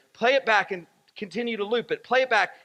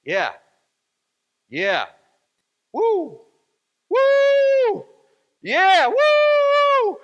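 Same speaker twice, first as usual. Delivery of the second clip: imitated dad's cheering; 14 semitones higher, 9 dB louder, much slower than usual. Preacher imitating his dad on the game film, cheering louder and higher. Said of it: "I could hear this voice saying".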